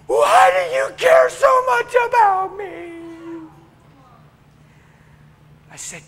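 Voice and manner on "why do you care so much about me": higher, louder, slow crying cry; crying shout. Retold or imitated. Prostitute cries out to preacher, sobbing shout. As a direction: throw it out as a sobbing plea. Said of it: "burst out crying".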